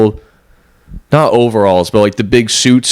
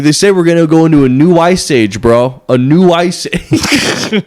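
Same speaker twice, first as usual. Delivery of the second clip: higher voice with a drawn-out slow A new ice age; intense bro; 6 semitones higher, slower than usual. Retold or imitated. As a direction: ham up the stranger's intense ice-age warning. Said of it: "He's like".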